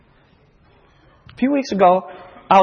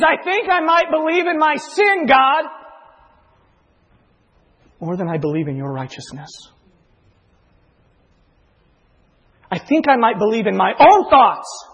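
impassioned confession; 7 semitones higher than usual. Retold or imitated. Sinner confessing before Almighty God, high and impassioned. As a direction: pour it out in a rising wail. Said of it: "what you're saying before Almighty God is".

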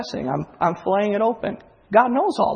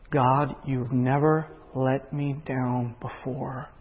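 humble prayer; 5 semitones lower, 5 dB quieter than usual. Retold or imitated. Believer quietly praying to God, soft and trusting. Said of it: "and you say".

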